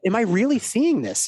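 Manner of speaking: amazed at what he sees; stunned disbelief. Retold or imitated. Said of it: "it was like".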